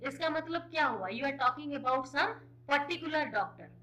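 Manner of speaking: usual speaking voice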